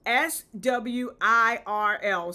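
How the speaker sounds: slow, deliberate spelling of S W I R L; spelled out letter by letter. Shown as a demonstration. Clearing up the squirrel confusion by slowly spelling the word. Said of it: "I am saying swirl".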